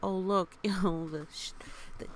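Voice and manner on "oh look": ordinary delivery, nothing marked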